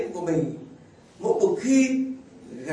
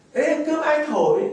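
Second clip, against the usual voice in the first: high, louder teasing question; teasing father; 10 semitones higher, 6 dB louder than usual. Retold or imitated. A father teasing his wife who cooked the rice, loudly. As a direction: tease back as the father. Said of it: "bố tôi mới hỏi".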